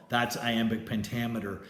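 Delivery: normal talking voice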